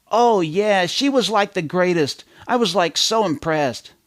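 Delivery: lightly voiced gushing teenager, high; a hint of a gushing teen; valley-girl teen. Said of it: "imagine a journalist interviewing a teenager".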